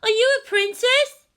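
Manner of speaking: put-on very high, eager child's voice; squeaky wide-eyed child; excited little girl. A narrator's reading. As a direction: ask like an awed kid.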